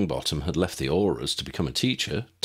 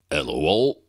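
gruff giant voice; 4 semitones lower, 4 dB louder than usual. Acted. Hagrid greeting the group.

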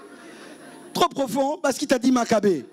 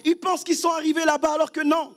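raised, insistent sermon voice; fervent preaching; 5 semitones higher than usual. Acted. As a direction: drive the warning home to the congregation. Preaching about the Pharisees. (le pasteur met l'église en garde, prédication fervente)

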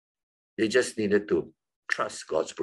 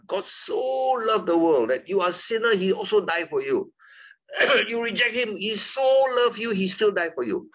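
higher, fervent preacher voice, imitated; a hint of his former pulpit fervor; mock old-style preaching; 9 semitones higher than usual. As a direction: replay the way he used to preach it. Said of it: "I preach this for many years like that".

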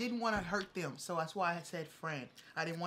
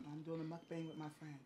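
quiet; 11 dB quieter than usual. Real, not acted. Talking on phone near partner.

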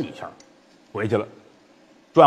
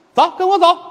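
put-on child's urging; 14 semitones higher, 5 dB louder than usual. Child pulls him away.

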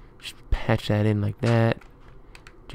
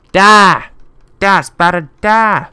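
high, loud bursts; punchy high battle cries; attack yell; 9 semitones higher, 8 dB louder, slower than usual. Real, not acted. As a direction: shout each word as a blow at the spider. Attacking spider in game.

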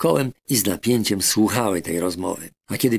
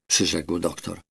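usual speaking voice

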